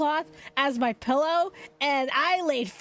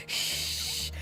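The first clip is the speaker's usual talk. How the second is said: soft, breathy "shh" in imitation; retold shush; 6 dB quieter than usual. Voice actress hushes Phil about being recognized, retold shush. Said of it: "She's like".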